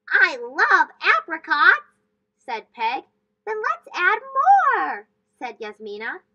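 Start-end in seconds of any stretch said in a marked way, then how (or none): 0.1-1.9 much louder, slow, gushing; excited. Acted. Peg thrilled by apricots. 3.4-5.1 higher, much louder, slow; excited. Acted. Yasmina eagerly agreeing.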